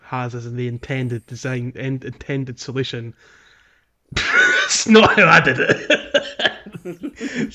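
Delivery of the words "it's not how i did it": high, loud, slow laughing admission; helpless laughter pushing the voice up. Real, not acted. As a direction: burst out laughing through the words. Bursts out laughing at intended solution.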